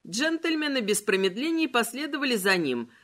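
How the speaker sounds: ordinary delivery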